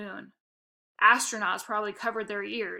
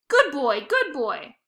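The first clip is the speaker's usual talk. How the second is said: 1 dog praise; 4 semitones higher, 7 dB louder, slower than usual. Acted.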